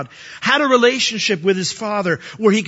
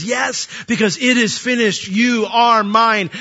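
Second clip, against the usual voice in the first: emphatic cry; 4 semitones higher, slower than usual. Retold or imitated. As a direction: proclaim it back with full force. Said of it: "He cries out and he says".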